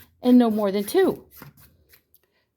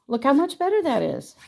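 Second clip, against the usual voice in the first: normal talking voice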